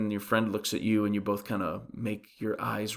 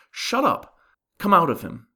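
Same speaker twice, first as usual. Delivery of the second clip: sharp, high-pitched command; stern rebuke; 6 semitones higher, 4 dB louder than usual. Retold or imitated. Jesus rebuking the demon, stern command. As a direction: rebuke it in short, raised-pitch orders. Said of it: "He speaks sternly to the demon".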